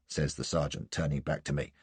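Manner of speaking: plain reading voice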